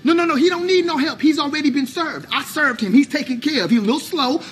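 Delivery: cocky dismissal. Acted. Defiantly answers for rival before customer.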